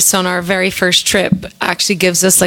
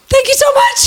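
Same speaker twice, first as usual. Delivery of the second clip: high squealed thank you; mock gushing thanks; faster than usual. Band thanks the waitress, high and gushing. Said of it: "we were like".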